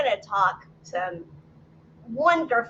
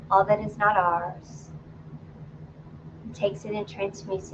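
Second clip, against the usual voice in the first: calm, lower meditation voice with long pauses; guided meditation; 4 semitones lower than usual. Acted. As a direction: guide them gently inward, phrase by phrase. Leads a grounding meditation.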